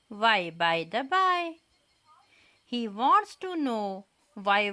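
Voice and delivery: slow, measured recital with each why lifted; poem recitation. Shown as a demonstration. Teacher reciting the poem Why to the children, slow and measured. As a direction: recite it line by line, slowly and deliberately, for young pupils. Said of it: "Let us recite the poem Why".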